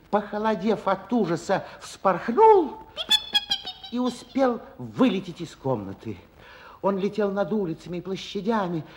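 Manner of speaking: ordinary talk, nothing marked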